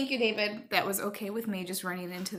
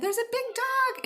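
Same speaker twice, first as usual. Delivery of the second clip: put-on high, breathless kid's warning; much higher urgent alarm; frightened warning. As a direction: blurt it out scared, pitched way up. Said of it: "And I said".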